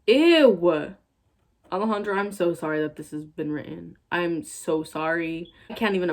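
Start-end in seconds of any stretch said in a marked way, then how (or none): 0.1-0.9 disgusted ew. Real, not acted. Disgust at the fanfic.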